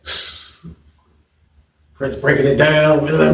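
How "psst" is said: soft unvoiced psst; sneaky little psst; retold psst. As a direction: hiss a quick psst to get attention. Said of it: "Prince was like".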